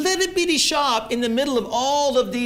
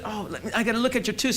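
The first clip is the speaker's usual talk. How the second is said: retold quick remark; 4 dB quieter, much faster than usual. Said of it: "She says".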